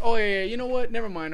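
high, loud, offended snap at first; offended retort. Retold or imitated. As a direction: act stung and take back the offer. Driver retold taking offense.

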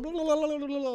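very high babbling blahs; high-pitched yammering imitation; mimicking nonstop chatter. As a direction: parody her running on and on. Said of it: "I didn't even hear half".

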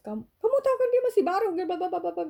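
fast, high, loud angry scolding; boss scolding. Retold or imitated. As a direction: tear into the caller fast. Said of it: "bos saya marah sama orang itu".

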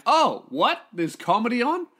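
high-pitched, put-out double take; mock surprise. Imitating bar patrons finding comedy is on, mock surprised. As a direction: imitate an unsuspecting punter caught off guard.

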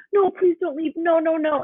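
high, pleading mock beg; imitating the girlfriend begging him to stay. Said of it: "and she's like".

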